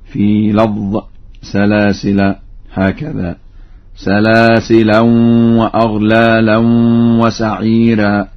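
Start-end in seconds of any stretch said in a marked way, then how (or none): 4.0-8.3 سلاسلا as "salasilan", nasal merged into the next w; with tanween. Shown as a demonstration. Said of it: "يقرأ بالتنوين". (سلاسلا بالتنوين)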